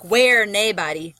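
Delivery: slow Scottish "where naebody"; Scottish accent. Shown as a demonstration. Teacher contrasting dialect with everyday speech, slow Scottish accent. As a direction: say "naebody" as NAY-body, Scottish-style, drawn out. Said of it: "if we were saying it".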